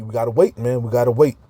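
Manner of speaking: ordinary talk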